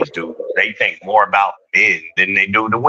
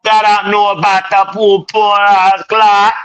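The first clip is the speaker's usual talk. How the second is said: high, loud Jamaican lilt; mock Jamaican; 11 semitones higher, 5 dB louder than usual. Riffing on the pum pum in a loud Jamaican accent.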